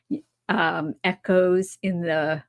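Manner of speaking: usual speaking voice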